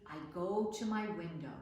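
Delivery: ordinary delivery, nothing marked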